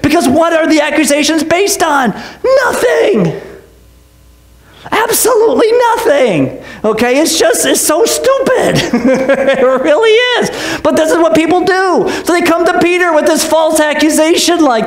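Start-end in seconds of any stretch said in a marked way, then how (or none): 2.6-6.6 high, incredulous dismissal; emphatic scornful verdict; exasperated emphasis. Real, not acted. 7.7-10.8 real amused disbelief; laughing. Real, not acted. Laughing at the absurdity.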